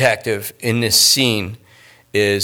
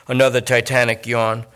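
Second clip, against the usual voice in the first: ordinary delivery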